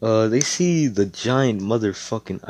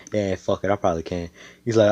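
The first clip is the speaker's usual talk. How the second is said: usual speaking voice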